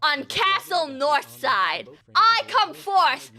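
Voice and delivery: mock-aristocrat with a giggle at the end; fancy lord voice. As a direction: play a pompous noble, drawn out. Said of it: "study up on how to speak fancy".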